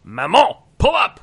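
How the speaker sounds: loud high yell up to the driver; shouting to mother. Acted. Son on car roof yells to mother driving, loud high shout. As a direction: bang the roof and yell at her. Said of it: "and I'm like".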